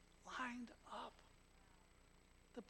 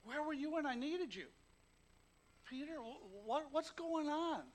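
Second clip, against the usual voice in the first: raised, hurt, scolding Jesus; 7 semitones higher, 6 dB louder than usual. Retold or imitated. Preacher voicing a Jesus who reproaches Peter. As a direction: act out the scolding he never gave. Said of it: "he doesn't say".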